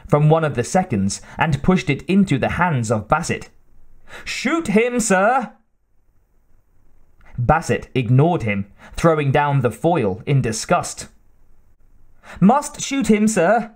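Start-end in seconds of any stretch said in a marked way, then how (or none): 4.2-5.5 high, urgent servant's cry. A narrator's reading. Hotchkiss pushing a pistol on his master, crying out. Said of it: "cried Hotchkiss". 12.4-13.7 high, insistent servant's plea; crying out. A narrator's reading. Hotchkiss dancing around his master, crying out. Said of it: "cried Hotchkiss".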